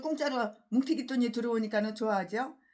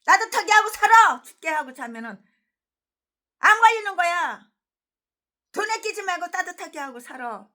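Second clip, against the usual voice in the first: caring loud advice; 6 semitones higher, 7 dB louder than usual. Retold or imitated. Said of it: "우리 원경 목사님이".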